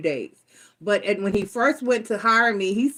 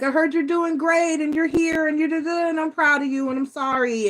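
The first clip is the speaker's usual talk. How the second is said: higher, lightly voiced former boss, proud; imitating old boss; 7 semitones higher than usual. Retelling how the old boss praised her, higher mock voice. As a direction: play the ex-boss gushing a bit, pitched up. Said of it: "And he was like".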